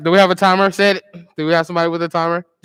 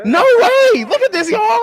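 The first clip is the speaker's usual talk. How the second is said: high, loud disbelieving cry; surprised; 14 semitones higher, 7 dB louder, slower than usual. Real, not acted. Counter sees the surprising tally, high loud shocked cry. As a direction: shriek in real disbelief.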